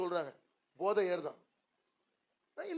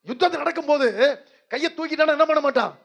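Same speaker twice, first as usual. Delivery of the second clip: loud, higher rhetorical question; fiery preaching; 7 semitones higher, 10 dB louder than usual. Acted. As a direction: challenge the crowd with rising fire.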